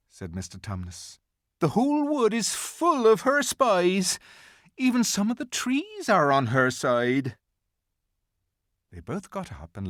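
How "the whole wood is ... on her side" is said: high, earnest little faun; a timid faun, pitched up and urgent; anxious faun voice. A narrator's reading.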